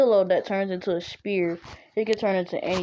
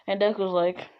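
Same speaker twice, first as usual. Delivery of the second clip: plain reading voice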